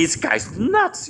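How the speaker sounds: slow, high, disbelieving mutter; mock the reader thinking he's crazy; incredulous aside.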